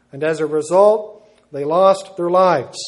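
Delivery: loud and slow, hammering the consequence; slow loud pulpit warning; emphatic preaching. Acted. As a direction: slam down the final warning. Preacher's loud solemn conclusion.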